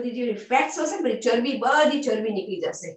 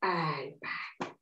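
much lower, much softer; soft release cue; 10 semitones lower, 13 dB quieter than usual. Real, not acted. Teacher bringing the class out of the pose, soft. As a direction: let them come back gently.

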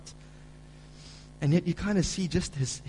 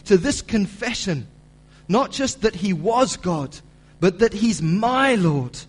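preaching at full force; 5 semitones higher, 8 dB louder than usual. Acted. Preacher reading the commentary aloud, building to loud emphasis. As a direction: drive the quote home to the climax.

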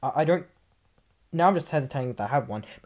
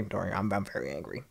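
lower, softer, deadpan claim of anger; deadpan mock anger; 8 semitones lower, 9 dB quieter than usual. Real, not acted. Youtuber telling mad viewers he's angry too, deadpan. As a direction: say you're furious with no anger at all.

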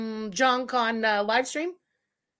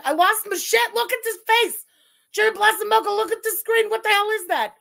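hysterical disbelief; 10 semitones higher, 6 dB louder than usual. Real, not acted. Cracking up at the screen.

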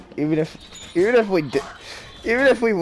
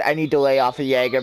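ordinary talk, nothing marked

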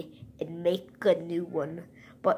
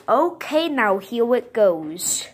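excited announcing; 4 semitones higher, 7 dB louder than usual. Pretend. Toy announces the stapling.

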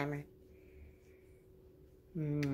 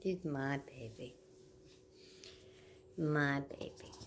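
slow tender cooing; 6 dB quieter, slower than usual. Real, not acted. Cooing over her dog.

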